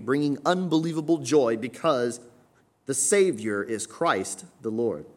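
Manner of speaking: ordinary delivery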